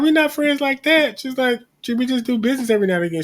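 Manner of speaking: high, mock-dismissive Candace voice; mimicking Candace. Retelling Candace brushing off the friendship, high and dismissive. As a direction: toss it off lightly like Candace brushing her off.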